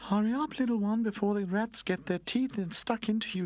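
high-pitched urgent warning; kindly streetlight calling. A narrator's reading. Streetlight warns the little one about the rats, high calling voice. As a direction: call it down in a high voice. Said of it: "she called to him".

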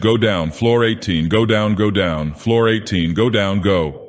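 usual speaking voice

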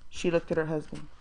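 usual speaking voice

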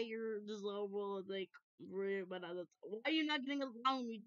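ordinary talk

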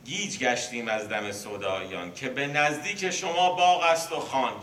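بیت را بلندتر و بالاتر، پرشور بخوان; شعرخوانی پرشور. Acted. Reciting a verse with rising force. (خواندن پرشور بیت مثنوی)

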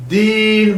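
Din said firmly with a clear voiced d; pressed din. Shown as a demonstration. Teacher isolates the word Din for students. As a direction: punch Din, never Tin. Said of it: "üstüne basa basa söylüyorum".

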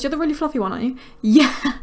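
normal talking voice

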